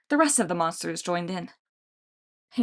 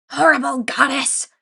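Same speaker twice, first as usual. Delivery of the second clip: loud, high monster bellow; bellowing Cyclops; 5 semitones higher, 6 dB louder than usual. A narrator's reading. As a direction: hurl it at the store in rage. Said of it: "she bellowed at the store".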